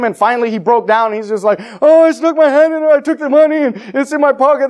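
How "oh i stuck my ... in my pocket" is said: put-on caught boy confessing. Boy confesses to his mom.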